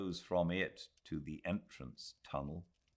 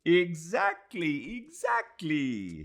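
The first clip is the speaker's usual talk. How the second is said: high, slow, drawn-out eager exclamation; thrilled high agreement; eager cry; 13 semitones higher, 6 dB louder, slower than usual. Acted. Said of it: "he cried".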